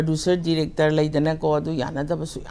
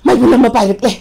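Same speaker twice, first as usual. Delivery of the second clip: possessed oracle; 13 semitones higher, 13 dB louder than usual. Shown as a demonstration. Artist demonstrates an oracle from a radio play, frenzied wailing. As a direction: cry out frenzied omens, gasping and cackling between lines. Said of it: "ꯂꯥꯏꯕꯥꯎꯒꯨꯝꯕ".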